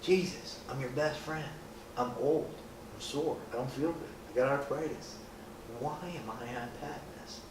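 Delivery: quiet, tired complaining to Jesus; whiny old apostle. Pretend. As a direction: grumble softly like a sore old man. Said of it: "I'd be like".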